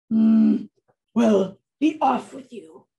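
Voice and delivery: loud troll grunt and dismissal; grunt, then send them off like a troll; grumbling storybook troll; gruff troll. Acted. Troll dismisses the little goat.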